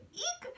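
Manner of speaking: high delighted eek; excited squeal. Real, not acted. Gushing over the boys' kiss, high excited squeal. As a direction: squeal with glee.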